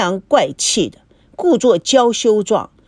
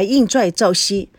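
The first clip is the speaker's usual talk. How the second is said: ordinary delivery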